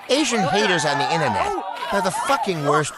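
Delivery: normal talking voice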